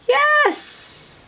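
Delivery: squealed high cheer; excited yes. Real, not acted. Reader cheering for Officer Buckle. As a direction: burst out in delight.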